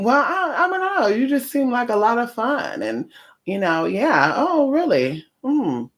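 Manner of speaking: high mock-innocent questioning; mocking a prying stranger. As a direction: imitate a nosy busybody fishing for gossip. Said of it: "Or they'll be like".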